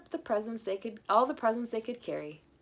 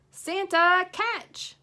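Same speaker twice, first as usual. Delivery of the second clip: high, loud call; 12 semitones higher, 9 dB louder, much slower than usual. Acted. Jennifer calling Santa to catch the ball, loud. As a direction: call across the street to Santa.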